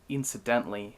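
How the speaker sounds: plain reading voice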